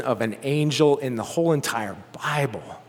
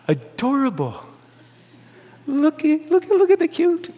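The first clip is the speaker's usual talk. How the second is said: put-on much higher, gushing baby-talk voice; mock gushing coo; 10 semitones higher than usual. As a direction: fawn over something cute, hammed-up. Mocking cooing at an angel.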